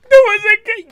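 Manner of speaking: very high, loud squeal; high-pitched outrage. Real, not acted. Reactor protests about the gap, high squealed shout.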